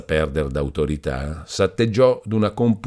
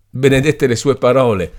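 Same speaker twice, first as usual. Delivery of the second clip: higher and louder grateful exclamation; exclaiming; 8 semitones higher, 7 dB louder than usual. A narrator's reading. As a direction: burst out in relief and gratitude. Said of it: "esclamò il giovane".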